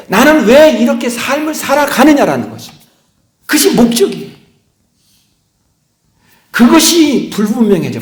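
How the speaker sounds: loud, high-pitched sermon delivery; impassioned preaching. Acted. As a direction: preach it out, pressing each question on the congregation. Preaches with rising force. (높고 힘찬 목소리로 열정적으로 설교하듯)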